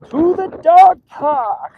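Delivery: high, drawn-out put-on voice; playful announcement. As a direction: stretch it out high, playfully heralding the outing. Playful repeat of the destination.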